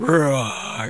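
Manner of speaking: mock monster roar; playful growl to scare a child; scary growl. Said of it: "his father growled in a scary voice".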